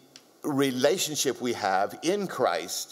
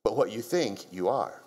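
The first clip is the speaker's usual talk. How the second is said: higher, insistent preacher voice; emphatic preaching; 4 semitones higher than usual. Acted. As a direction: drive each point home. Preacher building a point.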